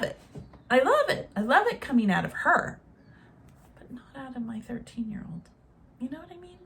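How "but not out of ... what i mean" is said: a soft, hushed whisper; whispered aside. Real, not acted. To viewers about her thirteen-year-old, hushed whisper. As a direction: drop it to a confiding whisper.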